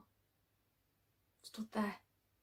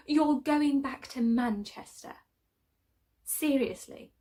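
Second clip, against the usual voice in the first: higher, louder, incredulous question; acted-out disbelief, pitched up; 5 semitones higher, 9 dB louder, slower than usual. Friend questions move to Manchester.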